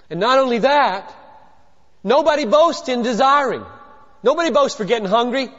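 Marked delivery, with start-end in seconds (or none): none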